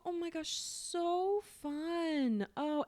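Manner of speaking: high, very drawn-out affected gushing; affected silly voice. Retold or imitated. Sales rep gushing to a coworker, affected silly voice. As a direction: drag out the vowels in a silly high voice. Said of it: "she has this very affected".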